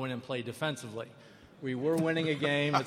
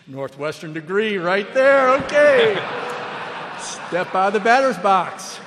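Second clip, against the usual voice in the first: louder, sarcastic jab at himself, voice jumping high on the okay; self-mocking; 6 semitones higher, 14 dB louder than usual. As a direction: laugh at your own blunder, much louder. Mocking himself after umpire's rebuke.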